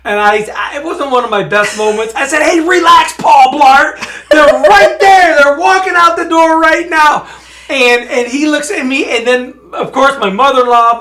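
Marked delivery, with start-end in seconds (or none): 2.4-7.3 loud, raised-pitch snap at an official; retold angry yell. Driver yelling at airport security in a retold outburst. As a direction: re-enact losing your temper, louder and higher. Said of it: "Dad just yelled at the".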